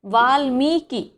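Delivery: वाल्मीकि drawn out slowly, syllable by syllable; teacher modelling वाल्मीकि slowly; slow enunciation. Shown as a demonstration. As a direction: stretch वाल्मीकि so the short कि is clear. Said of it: "इसे कैसे पढ़ते हैं?".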